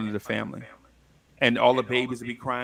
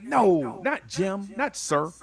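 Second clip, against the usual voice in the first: higher-pitched retort in a quote; retold firm correction; 6 semitones higher, much slower than usual. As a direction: act out Jim setting them straight.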